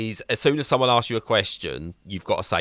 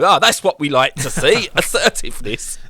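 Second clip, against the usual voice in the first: laughing executives; 6 semitones higher, 5 dB louder than usual. Retold or imitated. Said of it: "And they go".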